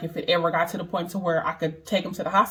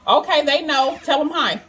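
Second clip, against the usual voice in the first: higher, brighter coaxing voice to her little son; 7 semitones higher, 4 dB louder than usual. Real, not acted. Mother coaxing her son to greet viewers, higher coaxing voice.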